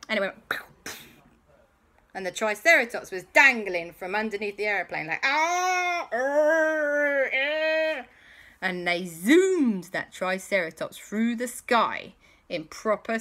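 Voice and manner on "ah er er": high, far-off whimpering cry; a scared beast wailing as it dangles; high dinosaur wail. Acted. Triceratops dangling from the plane, wailing high.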